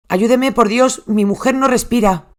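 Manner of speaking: panicked plea. Retold or imitated. Husband begging the 911 operator, frantic.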